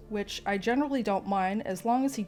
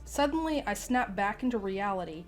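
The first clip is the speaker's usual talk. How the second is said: ordinary delivery, nothing marked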